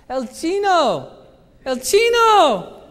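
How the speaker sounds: put-on high, loud, slow shout of a little boy; a little boy spotting the president and yelling his nickname; boy calling out. Boy in the street yells at the president's car, high and loud. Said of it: "he looks in the car and he goes".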